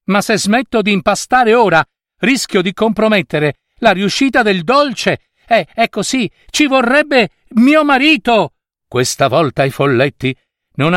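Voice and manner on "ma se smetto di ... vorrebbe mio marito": higher, louder raised voice. A narrator's reading. Baker calling over her screaming baby, loud. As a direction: push the words over the noise. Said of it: "disse a gran voce la donna".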